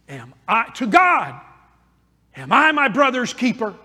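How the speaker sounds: a hint of Cain's arrogant defiance, lightly voiced; arrogant retort. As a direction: toss the question back with mild scorn. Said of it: "look at the arrogance".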